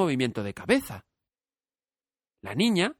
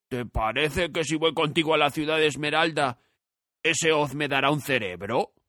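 lightly voiced eager asking; hope in every word; hopeful scarecrow; 3 semitones higher than usual. A narrator's reading. Scarecrow asks Dorothy if Oz will give him brains.

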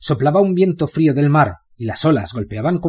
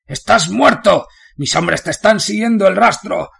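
shrill threat; 8 semitones higher, 4 dB louder than usual. Acted. Captive warden threatening Bane, high and desperate. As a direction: bluster though terrified.

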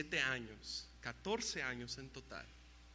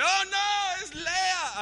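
very high, loud horrified shout; high horrified yell of "Oh, no"; exclamation shout; 12 dB louder, much slower than usual. Retold or imitated. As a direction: cry out in shock at who's in the bed. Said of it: "signos de exclamación".

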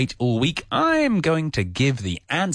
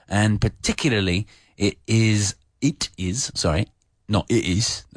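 usual speaking voice

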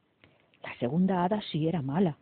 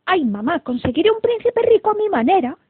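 high, loud protesting princess; stubborn daughter's loud retort; defiant exclamation; 11 semitones higher, 9 dB louder than usual. A narrator's reading. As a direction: whine back at mother, headstrong. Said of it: "exclamó la princesa Rosamunda por toda respuesta".

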